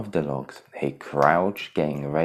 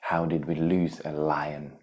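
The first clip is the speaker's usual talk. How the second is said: concerned; 2 semitones lower, 5 dB quieter than usual. Acted. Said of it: "Pumbaa added, concerned".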